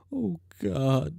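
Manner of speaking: ordinary delivery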